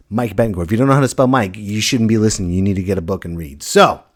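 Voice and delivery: normal talking voice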